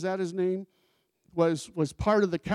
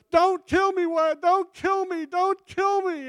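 high, loud panicked pleading; begging, crying; 14 semitones higher, 7 dB louder than usual. Retold or imitated. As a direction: beg for your life in a high cracking voice. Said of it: "and he's crying".

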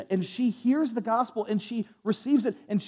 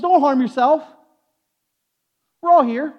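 louder, higher acted shout; urgent calling out; 4 semitones higher, 7 dB louder than usual. Paul to the jailer about to harm himself, calling out urgently. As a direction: call out to stop someone in time.

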